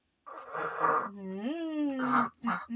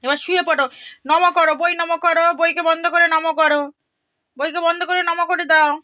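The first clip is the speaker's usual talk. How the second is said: loud firm instruction; 8 dB louder than usual. Real, not acted. Telling toddler to close book.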